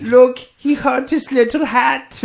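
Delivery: high, loud, slow mock German; fake German accent. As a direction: shout it like a Beer Fest German. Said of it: "They all talk with this".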